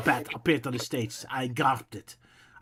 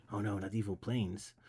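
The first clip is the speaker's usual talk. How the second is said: deadpan sarcastic; 6 semitones lower, 11 dB quieter than usual. Real, not acted. Aside mocking story line.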